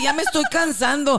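tired mock complaint. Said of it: "Entonces yo decía".

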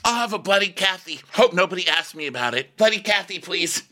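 high-pitched parody of a drink order; put-on high housewife voice; mock housewife. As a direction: lift the voice high, hamming up the order.

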